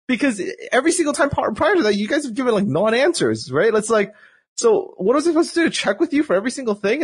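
high-pitched, indignant disbelief; exasperated protest. Retold or imitated. Player arguing back at developers about asking first, acted exasperation. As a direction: plead your case, pitch way up, incredulous.

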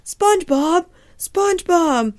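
high cartoon crab calling out; Mister Krabs. Crab boss calls SpongeBob back, high put-on voice. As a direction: holler the name in a high put-on voice. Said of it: "Mister Krabs is like".

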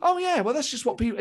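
hammed-up scoffing fool; a fool brushing it off, high pitched mock scorn. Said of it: "a fool would watch that and go".

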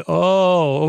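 a mock dawning realisation; retold realisation. Sister's sudden realisation, retold.

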